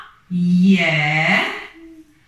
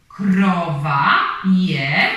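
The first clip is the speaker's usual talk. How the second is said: put-on each word stretched slowly for a child; slow, drawn-out reading; much slower than usual. Shown as a demonstration.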